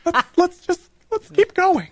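high chirpy lady voice; squeaky lady changing subject; man doing a woman's voice. Acted. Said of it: "your girl voice".

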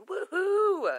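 high, loud whoop of joy; cheering woohoo. Real, not acted. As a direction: whoop it up for the crowd. Host cheers for new season.

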